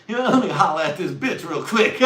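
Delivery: loud, high holler through laughter; laughing mock holler. Teen boy hollering at a girl, laughing. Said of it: "I was like".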